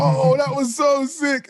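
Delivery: slow, high, dreamy delight; mock-marvel at a dream bar; half-asleep wonder, drawn out; awed delight.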